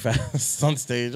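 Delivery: usual speaking voice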